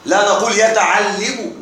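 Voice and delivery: ordinary talk, nothing marked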